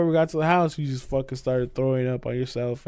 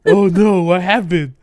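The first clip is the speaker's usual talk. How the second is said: louder, rising panic; louder panicked repeat; alarmed repeat; 3 semitones higher, 9 dB louder, slower than usual. Retold or imitated. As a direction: say it again with mounting alarm.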